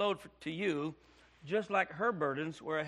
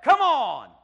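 loud, higher, impatient mock shout; scornful shout; 7 semitones higher, 11 dB louder than usual. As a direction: bark it like a dismissive churchgoer. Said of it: "how people treat you".

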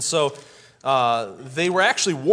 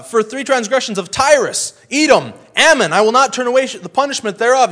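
high, loud prophetic proclamation; fiery preaching; 8 semitones higher, 10 dB louder than usual. Retold or imitated. Amos pronouncing judgment on Tyrus, high and loud. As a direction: declare judgment like a fired-up prophet.

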